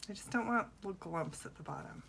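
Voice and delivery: usual speaking voice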